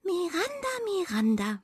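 softly warning little mouse; gentle cautioning call. A narrator's reading. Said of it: "warnte die Waldmaus sie".

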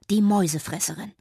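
usual speaking voice

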